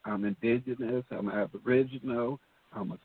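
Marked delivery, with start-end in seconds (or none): none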